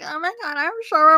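hammed-up ditzy girl after a fall; annoying girl voice. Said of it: "the most, like, annoying voice".

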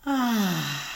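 loud, open release of breath; loud sigh. Shown as a demonstration. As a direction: let it all out in a big sigh. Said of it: "let the breath out with a loud sigh".

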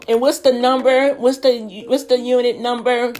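high, loud, snippy imitation of the clerk; mock the clerk's impatient questions; curt clerk, mimicked. Mimicking the clerk asking her for the unit number, high and loud.

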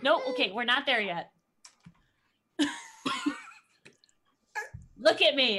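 usual speaking voice

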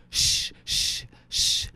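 unvoiced shushing hiss. The Russian hushing the cell block, shushing. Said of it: "the Russian like".

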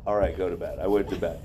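low, subdued mock compliance; giving in. Speaker obeys own order to leave the refrigerator, resigned. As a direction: drop the pitch and comply, resigned.

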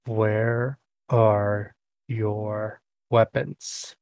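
very slow, word by word; breath, then laboured. Acted. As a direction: take a breath and space out every word. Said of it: "a breath and just sort of".

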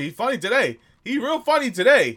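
very high, loud taunt; mocking heckle. Real, not acted. Podcast host teasing his co-host for being funny, loud mockery.

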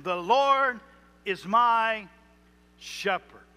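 The Lord, then is my, then shepherd, each set apart by pauses; word by word. Shown as a demonstration. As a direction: break "The Lord is my shepherd" into separate words with pauses. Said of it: "You break down every word".

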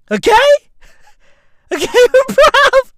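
high loud "okay, bruv"; high shouted disbelief. Acted. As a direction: shriek it in mock disbelief. Exaggerated outrage.

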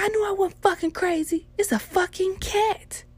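high, loud shrieking mother; imitating mom's shriek. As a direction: squeal it like a vindicated mom. Mom screams, vindicated.

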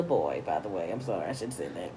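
deep male voice. Pretend. Cohost reading a male listener's email, low man voice. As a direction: drop into a low guy voice.

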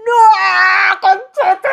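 high, loud outcry of dismay; shocked shout. Retold or imitated. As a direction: yell it, half crying. Shocked reaction to hearing "Israel. (grito alto, medio llorando)

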